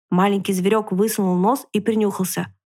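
plain reading voice

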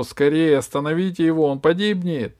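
громкий высокий тревожный крик; закричать в испуге за другого. Acted. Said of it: "закричал Гайдо". (Гайдо кричит, чтобы спасли жреца, громко)

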